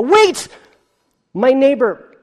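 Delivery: sudden loud cry of alarm; mock panicked cry. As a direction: shout "wait" as if stopping the priest mid-sacrifice. Worshipper stops the sacrifice, panicking.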